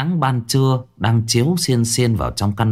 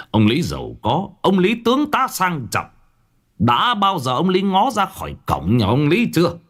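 higher, mocking, rising scorn; scornful rebuke; 10 semitones higher than usual. A narrator's reading. Angry old master mocking Lý Hương, a higher scornful lecture. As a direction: sneer at the rich man's pride. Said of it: "ông đã nổi giận".

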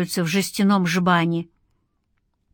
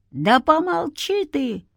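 shouted hush; 7 semitones higher than usual. A narrator's reading. Uncle behind silencing a reckless man, shouted. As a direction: call out high to shut him up. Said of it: "закричал из-за спины Егор".

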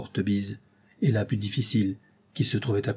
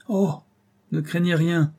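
une ironie amère, à peine marquée; mordant, un peu plus aigu; 7 semitones higher, 3 dB louder than usual. A narrator's reading. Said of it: "avec la plus amère ironie". (ironie amère légèrement jouée)

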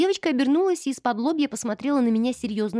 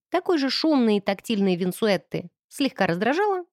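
usual speaking voice

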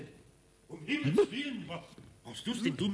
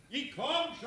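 higher call to the next room; 11 semitones higher, slower than usual. Acted. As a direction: call over to the players. Calling to card players.

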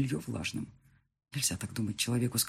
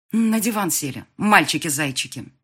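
raised, higher-pitched scolding order; angry; 4 semitones higher, 6 dB louder than usual. A narrator's reading. Angry woman orders both men onto the sofa, scolding. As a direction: order them about like naughty boys. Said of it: "Такая злая".

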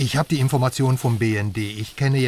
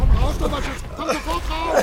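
frightened order from the shore; urgent shout; 14 semitones higher than usual. Acted. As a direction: yell at her to get out now. Ordering her out of the lake.

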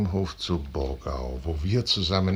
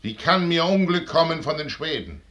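empörte Abwehr; 8 semitones higher, 5 dB louder than usual. Acted. Wallenstein weist die Warnung zurück.

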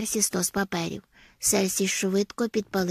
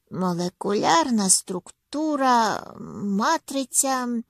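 very slow, louder, elongated vowels in СТРУКТУРА; slow, drawn-out musing; 4 semitones higher, 4 dB louder, slower than usual. A narrator's reading. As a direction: stretch МАТРИЦЯ out slowly and higher. Sleepy man straining to concentrate.

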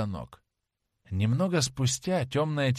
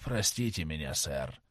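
near whisper; 7 semitones lower, 6 dB quieter than usual. A narrator's reading. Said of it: "прошептал Джеймс Вен".

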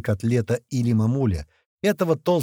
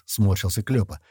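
ordinary delivery

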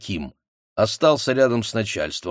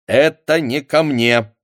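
slow, firm, a bit louder refusal; curt cutoff; 5 semitones higher, 6 dB louder, slower than usual. A narrator's reading. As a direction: cut her off flatly. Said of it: "отрезал председатель". (отрезал сухо)

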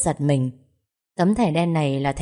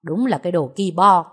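scornful sneer. Acted. Husband sneers at stingy relative.

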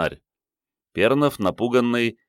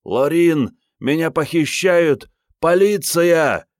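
high, loud, drawn-out wail; wailing cry for help; 10 semitones higher, 5 dB louder, slower than usual. A narrator's reading. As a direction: cry out slowly to be rescued. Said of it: "и возопил".

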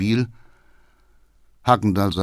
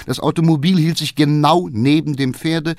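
ordinary talk

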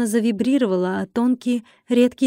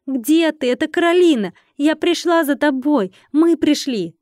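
raised, urgent searching call; 5 semitones higher, 4 dB louder than usual. A narrator's reading. Heroine searches for captive friend, urgent calling. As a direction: call through the house to find someone. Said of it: "крикнула я".

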